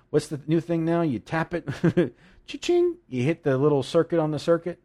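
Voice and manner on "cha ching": high-pitched cash register "cha-ching". Shown as a demonstration. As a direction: imitate a till ringing up, jumping high. Imitates a card payment register sound.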